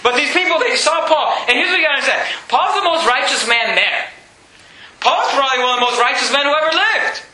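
usual speaking voice

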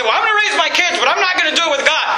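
mock protest, rising in pitch; mock defiant parent. Acts a self-reliant parent.

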